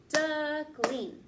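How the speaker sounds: Duckling split into two loud beats, duck and ling; clapped in beats. Shown as a demonstration. Teacher clapping syllables for kids, loud and clear. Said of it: "Now let's clap it".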